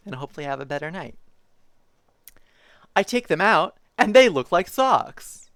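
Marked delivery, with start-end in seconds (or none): 3.0-5.5 lively picture-book reading voice; reading to children. Acted. Streamer reading a zoo picture book to chat, bouncy storytime voice. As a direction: read it like storytime for little kids.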